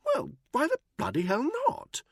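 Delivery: high, loud, nervous stammer; stammering Buckingham. A narrator's reading. Buckingham cornered by Danny, stammering high. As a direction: trip over the words in a high upper-class voice.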